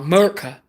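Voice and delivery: "America" squashed into 'Murica; mock patriotic pronunciation. Mock-patriotic slang after "I love America.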